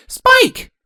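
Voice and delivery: very high, loud call; high-pitched princess yell; shout. A narrator's reading. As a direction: call out to the dragon. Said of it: "Twilight shouted to the dragon".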